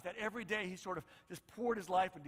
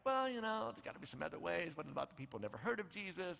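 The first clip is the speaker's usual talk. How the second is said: embarrassed mumble; 5 semitones lower, 6 dB quieter than usual. Retold or imitated. Embarrassed Christians voiced mumbling.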